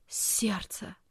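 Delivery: weak, soft whisper. A narrator's reading. Dying aunt naming the source of pain, whispering. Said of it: "вдруг прошептала тётя".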